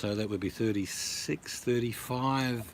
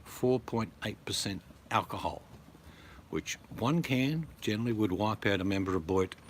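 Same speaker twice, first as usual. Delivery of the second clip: ordinary talk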